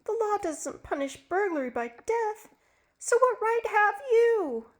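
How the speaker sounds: high, tense woman's voice; pleading wife. Acted. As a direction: challenge him, desperate.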